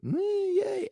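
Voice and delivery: tiny squeaky yay; very high, small-voiced celebration; high-pitched cheer. Cheering team's win from highlights.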